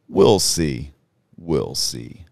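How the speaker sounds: weighty divine verdict, settling low; deep, majestic voice. Retold or imitated. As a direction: pronounce it like God, then let it sink. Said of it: "in this very deep, majestic voice".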